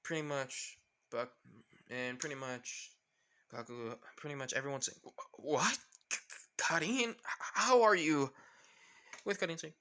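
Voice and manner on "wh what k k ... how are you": acted shocked stuttering; stunned stammer. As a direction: stutter on the first sounds, rising higher. Everyone stunned, stammering at Karin.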